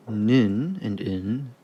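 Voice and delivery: usual speaking voice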